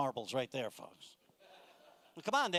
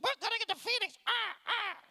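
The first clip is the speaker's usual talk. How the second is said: much higher parrot squawk. Retold or imitated. Comic imitating a parrot asking directions, high squawk. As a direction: screech it like a lost parrot.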